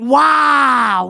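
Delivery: a sudden loud shout of wow. Real, not acted. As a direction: blurt it out loud in shock. Streamer reacts to rage moment.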